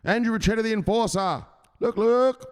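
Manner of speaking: high, loud announcer-style intro; excited hype introduction. Real, not acted. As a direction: hype up the enforcer.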